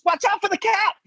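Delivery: high-pitched panicked mother; alarmed warning. Retold or imitated. Mother warning father as he drives, high alarm. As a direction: mimic a sudden shrieked warning, very high. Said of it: "my mom goes".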